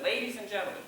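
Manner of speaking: high-pitched ringmaster greeting; lifted, showy address; circus announcer tone. Shown as a demonstration. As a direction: announce it brightly to a big crowd. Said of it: "almost waiting for a three-ring circus".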